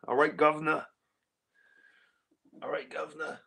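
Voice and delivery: playfully imitating a London taxi driver's Cockney; Cockney cabbie. Imagining streamer Mark Taylor as a London cabbie, mock Cockney accent. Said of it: "he sounds like your typical London cabbie".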